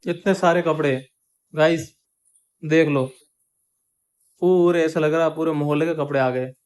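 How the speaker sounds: loud, amazed exclaiming. Real, not acted. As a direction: marvel at the laundry pile loudly. Aunt exclaims at the laundry pile.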